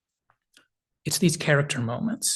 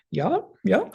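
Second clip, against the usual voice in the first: high, hesitant put-on yes with a nervous rise; awkward uneasy yeah; 7 semitones higher than usual. Scotty dodging the captain's question, awkward and uneasy. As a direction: hedge it like you're dodging the truth. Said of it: "this awkward, uneasy".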